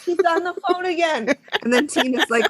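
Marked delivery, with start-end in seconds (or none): 0.0-1.4 much higher chirpy dispatcher voice; mock dispatcher calls a coworker about her; perky dispatcher. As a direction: play the gossiping dispatcher bright and high. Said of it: "she's like".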